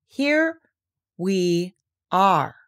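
very deliberate, word by word; slow and careful. A narrator's reading. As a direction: space the words out carefully with small pauses. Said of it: "very slowly and carefully".

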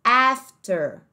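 after in a British accent, R dropped, afta. Shown as a demonstration. Teacher shows the British after to students. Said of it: "mais no sotaque British".

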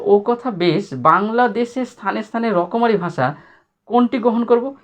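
reading the essay. Acted. Reads essay's question aloud.

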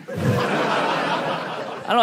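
slow airy laugh; slow breathy giggle; laughing shrug. Real, not acted.

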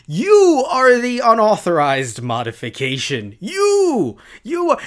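loud accusation rising to a high-pitched "You"; outraged outburst. Real, not acted. YouTuber turning the phrase on hackers, loud indignation.

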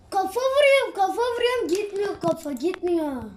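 real in-game exasperation; exasperated complaint. Real, not acted. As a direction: protest in disbelief, voice jumping up then falling. Player complains headshots will not land.

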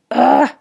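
loud frustrated "ugh"; a sharp exasperated groan; groan of dismay. A narrator's reading. Narrator realizing she's caught, frustrated groan.

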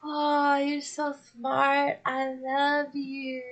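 soft, slow, adoring; gushing admiration. Retold or imitated. Streamer imitating how she swoons over a smart artist, soft and slow. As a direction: melt a little as you say it. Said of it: "I'm just like".

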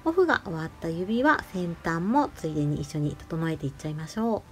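ordinary talk

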